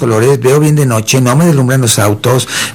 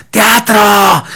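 shouted correction; 8 semitones higher than usual. Retold or imitated. Bisoño yelling a correction at the host. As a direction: heckle the word across the room. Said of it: "me gritaba Bisoño".

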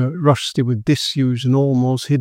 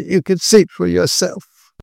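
higher-pitched anguished appeal; distressed; 6 semitones higher, 4 dB louder than usual. A narrator's reading. Fiancée appealing to Quinn, racked with distress. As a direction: plead through distress. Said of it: "her distress racking her".

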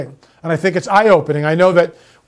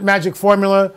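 ordinary delivery

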